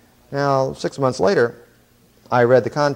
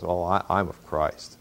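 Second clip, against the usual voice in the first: soft, slow, lower mock-holy voice; mock pious; 5 semitones lower, 6 dB quieter than usual. Preacher mimics a smug spiritual churchgoer, softly and slowly. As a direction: lightly send up the super-spiritual believers. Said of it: "the really spiritual ones".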